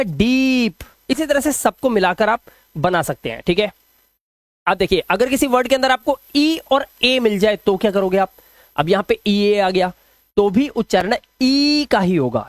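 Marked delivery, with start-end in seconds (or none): 0.0-0.8 डीप with the "ee" held long; long ee sound. Shown as a demonstration. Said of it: "ई की मात्रा लगेगी". 11.4-11.9 ई sustained as a long "ee"; teacher's demo of a long, held ई; long ee sound. Shown as a demonstration. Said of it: "long sound है".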